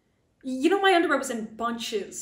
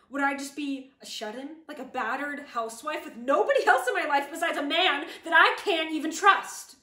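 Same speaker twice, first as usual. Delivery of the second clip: building exasperation; 3 semitones higher, 6 dB louder than usual. Acted. Confronting the man she might date, building exasperation. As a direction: rant rising to a loud finish. Building rant about losing her friends.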